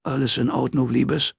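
concerned father. Acted. Father checking on his daughter in the garden, concerned. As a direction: ask gently after her. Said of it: "fragte Michael Ellicott seine Tochter".

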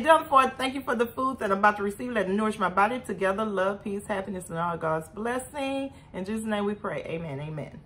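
soft, hushed grace before eating, fading toward the amen; mealtime prayer. Real, not acted. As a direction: pray quietly over the food. Blesses the food in prayer.

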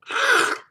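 sharp, high gasp; loud gasp. Acted. Host reacts to the recap, loud exaggerated gasp. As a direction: suck in a loud mock-shocked breath.

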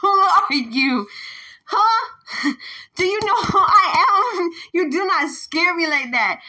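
loud defiant shouting with a laugh; loud confrontation. Real, not acted. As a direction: puff yourself up and shout back. Confronting the ghost.

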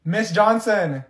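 ordinary talk

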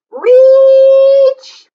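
high, loud stretched-out strain; straining reach. Pretend. Acting out the sheep straining to reach, high loud stretch.

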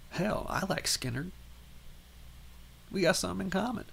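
a hint of a pleased Texan lawman; folksy sheriff. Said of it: "Lee Ermey is like".

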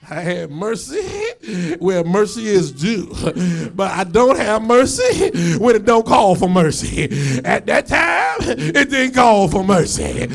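loud mock-solemn pulpit delivery; emphatic preacher shouting in rhythm; full-force preaching. Real, not acted. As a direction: preach it hard, landing on each phrase.

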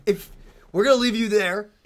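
ordinary delivery

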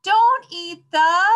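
high, loud, drawn-out shout; yelling. A narrator's reading. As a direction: stretch out a shouted warning, left hanging. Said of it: "yelled the children".

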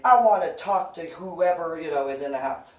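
loud pushy stranger, mildly voiced; man's demand. The man yelling at her.

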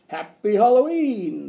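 high, booming holiday cheer; loud clown greeting. A narrator's reading. Clown arriving to greet the class, loud cheer. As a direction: belt it out, stretching the last word.

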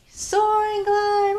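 high airy glide voice; high floaty echo. Shown as a demonstration. Leading kids' movement game.

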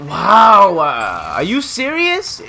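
loud, high, drawn-out disbelief; real outraged gamer reaction; incredulous shout. Real, not acted. Disbelief after dying in game.

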